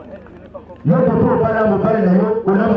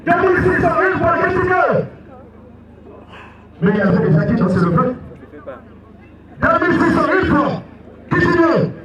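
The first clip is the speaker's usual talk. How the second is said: auctioneer; 8 semitones higher, faster than usual. Acted. Auction chant calling bids.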